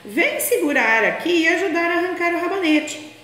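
grandma calling out to her granddaughter, high voice. Acted. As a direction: call the little girl over, high and bright. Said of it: "a vovó gritou para a netinha".

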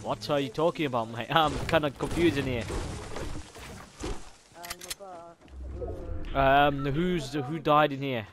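lightly put-on Aussie accent, bloody and mate; hint of Australian accent; mock Australian accent. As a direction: play an Aussie bloke in a game lobby. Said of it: "Crikey, mate".

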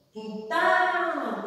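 Quintal with TAL loud and held; stress on last syllable. Shown as a demonstration. Teacher asking which syllable is strongest, TAL drawn out. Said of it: "é o mais forte".

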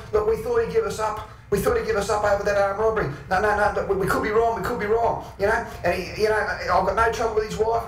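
high-pitched, pleading crim voice; panicked backpedalling. Acted. Cornered blokes pleading with the storyteller to let it go, panicked backpedalling.

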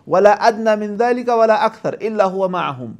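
slow, melodic Quran recitation; Quranic recitation. Acted. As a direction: chant the verse slowly.

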